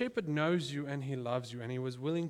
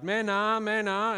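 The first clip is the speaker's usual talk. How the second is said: loud, higher call across the river; shepherd yelling to his sheep; shepherd's call; 5 semitones higher, 8 dB louder than usual. Retold or imitated. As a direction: call the flock to follow you. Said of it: "starts yelling out".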